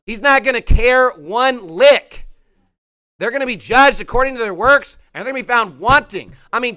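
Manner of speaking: loud, emphatic sermon delivery; full-force preaching. Real, not acted. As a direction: hammer each judgment home to the congregation.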